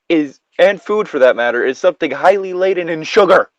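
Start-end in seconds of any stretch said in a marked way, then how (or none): none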